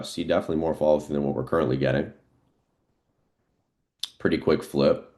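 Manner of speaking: ordinary talk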